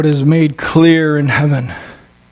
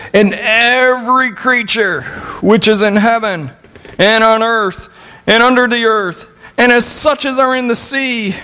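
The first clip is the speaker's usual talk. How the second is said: raised pitch, ringing scripture reading; proclaiming scripture; 7 semitones higher than usual. Real, not acted. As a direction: proclaim the verse to the congregation, high and sustained. Reads Revelation aloud, preaching.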